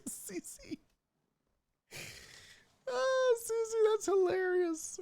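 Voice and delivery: high mocking voice. Mocking chat laugh, high.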